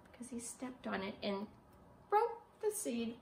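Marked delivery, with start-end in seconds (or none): none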